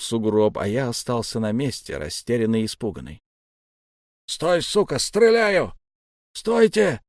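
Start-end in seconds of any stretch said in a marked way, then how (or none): 4.3-5.7 high, threatening shout; shouted challenge. A narrator's reading. Stormtrooper challenging a figure in the snow, shouting. 6.3-7.0 high, urgent yell; yelled plea. A narrator's reading. Wolf stopping his own side from firing, yelling. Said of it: "завопил волк".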